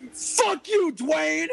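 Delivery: very high, strained mock yell; retold yell. Host yells at his friend from the stage, high-pitched mock yell. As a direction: holler it far up in pitch, playfully hostile. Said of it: "and I yelled".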